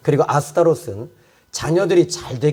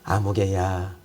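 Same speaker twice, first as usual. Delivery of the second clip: 아무개야 said much lower and softer, intimate; tender call; 9 semitones lower, 7 dB quieter, slower than usual. Acted. God calling a believer by name, softly. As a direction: murmur 아무개야 as a gentle invitation.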